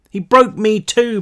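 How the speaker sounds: stuttered. A narrator's reading. Brick stuttering to Rilke. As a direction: stumble out the words in fear. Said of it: "Brick stuttered".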